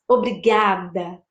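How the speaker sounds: low, slow thanks; thankful. Shown as a demonstration. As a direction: say it with heartfelt gratitude. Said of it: "Posso agradecer".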